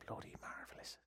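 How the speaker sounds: a hushed, put-on Irish lilt, nearly voiceless; whispered mock Irish brogue; whispered Irish accent. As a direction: breathe it like a sly Irishman sharing a secret. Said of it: "Everyone loves an Irish accent".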